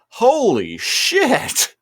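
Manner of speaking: high, drawn-out stunned exclamation; re-enacting a jaw-dropped reaction; retold shock. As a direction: stretch it out high in disbelief.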